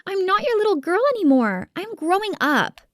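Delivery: very high pleading voice; protesting spoiled daughter. A narrator's reading. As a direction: whine back at Daddy in a high voice. Sister protests to her father.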